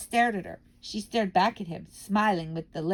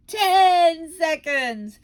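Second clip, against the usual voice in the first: very slow, loud, much higher cry; witch crying out; 9 semitones higher, 10 dB louder, much slower than usual. Acted. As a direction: drag the number out triumphantly. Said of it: "cried the Grand High Witch".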